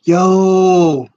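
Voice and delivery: ordinary talk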